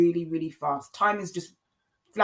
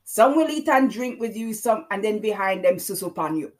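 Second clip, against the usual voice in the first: put-on Jamaican-accented line, spoken rather than sung; Jamaican twang; 5 semitones higher, 6 dB louder than usual. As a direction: say the lyric again in a lighter Jamaican lilt. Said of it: "That's my Jamaican, uh, twang there".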